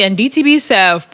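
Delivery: ordinary talk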